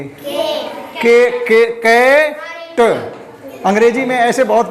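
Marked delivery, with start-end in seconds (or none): 1.4-2.8 कैट sounded out slowly, not as काट; slow phonics demo. Shown as a demonstration. Teacher correcting pupils, slowly sounding out कैट. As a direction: stretch के into कैट for the class. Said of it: "काट नहीं पढ़ेंगे".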